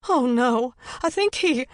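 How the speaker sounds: high, loud, voice breaking off; faltering panic. A narrator's reading. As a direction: panic that cuts off mid-word. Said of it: "Her voice faltered".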